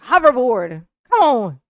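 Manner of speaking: loud triumphant blurt; excited. Real, not acted. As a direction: shout the answer like a winner. Blurting out the puzzle answer.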